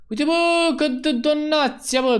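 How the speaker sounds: high-pitched, drawn-out cry of delight; excited outcry. Real, not acted. Streamer spotting a new donation, excited.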